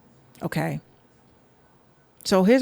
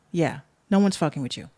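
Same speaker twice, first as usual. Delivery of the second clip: normal talking voice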